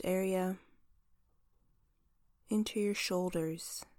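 soft, unhurried meditation voice; guided visualization. Acted. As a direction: guide them gently through the body.

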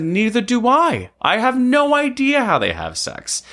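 playful high quote. Retold or imitated. Razzing a classmate about snakes, high mock-innocent tone. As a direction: toss it up high like a teasing joke. Retold banter teasing a friend.

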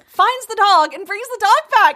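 high, rising excitement; gushing fan retelling, high-pitched; excited retelling. Real, not acted. Gushing over the hero rescuing dog.

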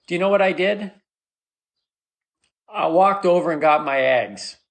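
ordinary delivery

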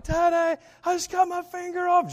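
put-on high, loud panicked child's cry; a kid shrieking for Daddy in terror; frightened child yelling to dad about a hurt finger.

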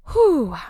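loud, high blown-out whew; big relieved whew. Real, not acted. Letting the teaching land, a big high whew. Exhales after intense teaching run.

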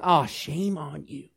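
quiet, slow mock disapproval; soft tsk-tsk scolding. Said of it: "You ever heard anybody say".

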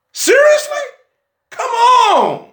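high, exclaimed disbelief; shrieked preacher's protest; incredulous outcry. Real, not acted. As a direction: cry out in disbelief to the congregation. Preacher scoffing at a joke.

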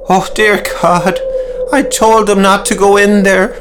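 man on the verge of a breakdown; howling lament. Acted. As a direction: wail that you warned them. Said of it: "Loud howls could be heard".

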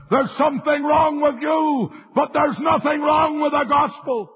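high, sustained pulpit cry; full-force preaching. Real, not acted. Preacher proclaiming the gospel to the congregation, high impassioned shout. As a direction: cry it out high, holding the pitch.

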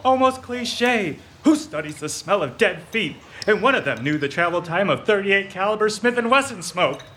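high, giddy gloating rant; gloating mania. Acted. Dawson gloats to the Boss about the dead detectives, giddy and high. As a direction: revel in how stupid they all were.

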